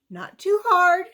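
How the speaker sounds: contented little voice. Acted. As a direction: weigh it up happily. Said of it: "she said".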